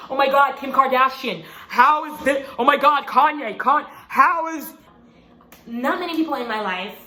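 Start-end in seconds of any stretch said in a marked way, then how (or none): 0.1-4.7 paparazzi. Retold or imitated. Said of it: "The paparazzi just like".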